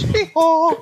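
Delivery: high, comic braying noise; donkey-like hee-haw. Retold or imitated. Mimicking the toy's noise for mates, high comic bray. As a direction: squeal out a silly hee-haw with a laugh. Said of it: "makes that noise every time".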